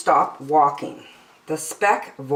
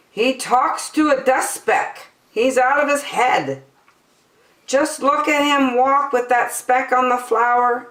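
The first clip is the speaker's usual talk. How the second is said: higher, mocking gossiping voice; higher sneering jungle gossip; 9 semitones higher than usual. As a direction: spread it like catty rumour.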